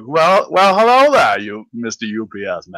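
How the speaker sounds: exaggerated cartoon-character impression, starting big; Randy from South Park. Quoting South Park to a friend, Randy impression.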